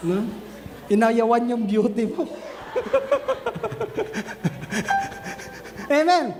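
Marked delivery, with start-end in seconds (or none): 2.3-5.8 breathy high laugh; airy laugh. Real, not acted. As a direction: laugh softly at the joke. Preacher laughing at own joke. (mahinang tawa)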